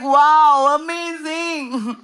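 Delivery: high, loud wow, then a drawn-out amazing; awed gasp. Retold or imitated. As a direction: gasp in over-the-top awe. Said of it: "You're like".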